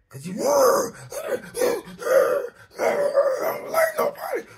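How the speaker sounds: high wailing shout of someone gone mad; mock crazed wail. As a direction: wail it like a tormented soul, loud and high. Voicing a mad mind, cry.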